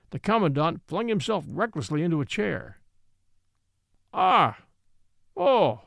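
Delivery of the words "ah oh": loud Irish exclamations Ah and Oh; a loud, reckless Irish outcry; Irish cry. A narrator's reading. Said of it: "he cried in pure Irish".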